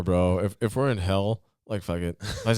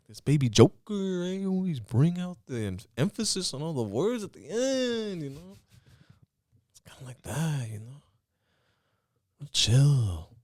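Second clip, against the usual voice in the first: high voice, stretched word endings; Little Joker; 8 semitones higher than usual. Shown as a demonstration. Comic showing his Little Joker take, high stretched endings. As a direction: lean on the end of every phrase, pitched up. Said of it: "here's Little Joker".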